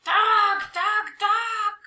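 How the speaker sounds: high loud wailing troll cry; troll wails. Acted. Trolls wail for the lost dog, high and loud. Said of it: "wails of".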